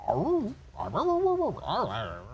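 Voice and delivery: playful imitation of a husky talking; husky howl imitation. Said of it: "I'll have a conversation and be like".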